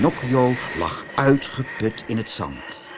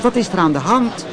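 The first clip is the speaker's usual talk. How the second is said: put-on high, quick little wooden boy asking; a puppet boy asking the crowd, quickly; puzzled puppet; 9 semitones higher, 3 dB louder, faster than usual. A narrator's reading. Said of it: "vroeg Pinocchio".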